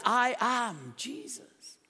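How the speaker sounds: each word drawn out slowly; slow weighty statement. Shown as a demonstration. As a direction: linger on every word. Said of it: "Do you see it? Can you hear it?".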